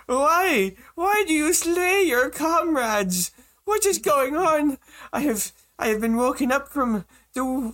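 very high-pitched little gnome voice; distraught mayor gnome. Acted. Mayor gnome scolding his village's attackers, high distressed gnome voice. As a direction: plead in a high, squeaky gnome voice, drawing out the outrage. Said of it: "see the mayor gnome come out".

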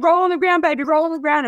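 put-on high, urgent call to a child. Mother yelling to her burning son outside the window, urgent. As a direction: call out to him to save him.

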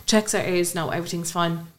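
ordinary talk, nothing marked

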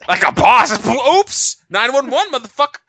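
hammed-up hype shout; mock-triumphant whoop. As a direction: cheer it like a victory. Cheering the accidental 911 call.

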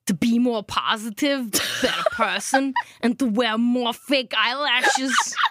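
put-on Austrian strongman accent, slowing at the end; Arnold Schwarzenegger impression. Reading a resolution as Arnold Schwarzenegger for her friend. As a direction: Arnold Schwarzenegger drifting into Gru. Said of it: "You nailed it at the top".

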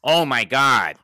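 loud excited entrance. Acted. Bob makes a loud entrance.

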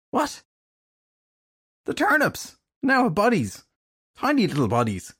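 high panicked outburst; alarmed shout. Acted. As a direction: blurt it out in alarm. Panic at turnip monsters.

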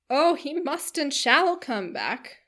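loud, high, slow exclamation; emphatic squire's outburst; insistent cry. A narrator's reading. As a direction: declare it with slow, hearty force. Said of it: "cried Sir John".

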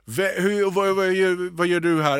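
ljusare röst som snubblar på frågeorden; tillkrånglad stamning. Retold or imitated. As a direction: spela upp hur du trasslar in dig i en artig fråga. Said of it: "Och så börjar jag trassla in". (förvirrat, härmat stammande)

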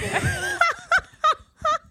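high, loud laugh close to crying; tearful laugh. Real, not acted. Burnt-out guest admits she is not okay, high tearful laughing. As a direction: break into high, sobbing laughter.

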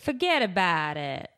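quick New York Italian "forget about it"; New York Italian accent. Shown as a demonstration. Friend demoing a New York Italian accent for her audition. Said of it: "like, New York Italian?".

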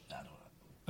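unvoiced, sneaky whisper; whispered aside. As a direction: whisper it like a shoplifter. Acting casual while stealing.

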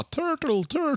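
put-on high, soft little-kid call; squeaky-high distant child's cry, kept soft; small child calling. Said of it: "Man hör ett litet barn ropa".

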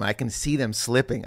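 ordinary delivery

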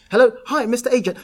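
high, loud cold-call greeting; eager sales pitch. Retold or imitated. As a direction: act out a keen novice caller. Acting out his naive sales pitch.

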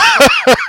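short loud high-pitched laugh. Real, not acted. Laughing at the accent joke with a high laugh. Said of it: "It's pretty good".

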